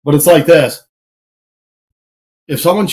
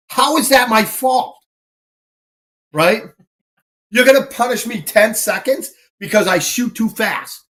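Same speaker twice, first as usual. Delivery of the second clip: mildly indignant, pitched-up retort; indignant protest; 5 semitones higher than usual. Retold or imitated. Shooter disputing a penalty with range officers, acted indignation. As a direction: argue back in disbelief, re-enacted.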